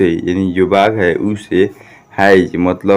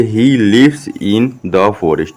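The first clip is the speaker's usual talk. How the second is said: very slow, spaced-out English words; model reading; much slower than usual. Shown as a demonstration. Teacher showing students how to read the sentence, slow word-by-word. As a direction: read it slowly, drawing out every word. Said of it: "तो इसे हम लोग कैसे पढ़ेंगे".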